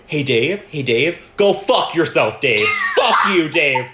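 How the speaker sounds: loud, high yelled insults; dad screaming at a phone scammer, retold; mock screaming. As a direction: imitate dad yelling down the phone. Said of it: "Screaming it".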